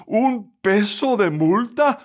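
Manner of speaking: high, drawn-out outraged question; indignant widow throwing the charge back; angry disbelief. A narrator's reading. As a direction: question it slowly, pitched high in anger. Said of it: "roja de ira, respondió".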